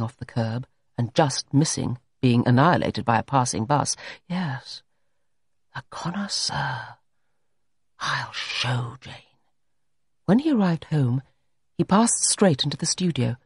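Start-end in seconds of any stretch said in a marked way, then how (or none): 4.3-9.1 slow, soft mutter; slow quiet resolve under the breath. A narrator's reading. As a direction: murmur the connoisseur slowly, pleased with the idea. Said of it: "muttered Alan Everard".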